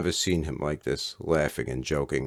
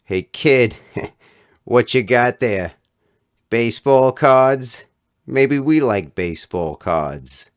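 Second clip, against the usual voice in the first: higher, louder, slow mocking taunt; taunting bullies; 9 semitones higher, 10 dB louder, slower than usual. Schoolyard punks cornering the boy for his cards, slow mocking taunt. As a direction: drawl it out to scare the kid.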